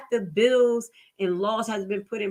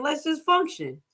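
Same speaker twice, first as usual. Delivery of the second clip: normal talking voice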